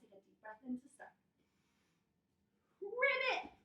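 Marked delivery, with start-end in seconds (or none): 2.8-3.5 high, loud playful frog call; frog ribbit. Pretend. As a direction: pipe it up like a jumping frog. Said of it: "we're gonna jump up and ribbit".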